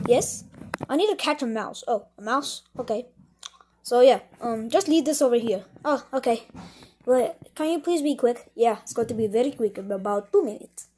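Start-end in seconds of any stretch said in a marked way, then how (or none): none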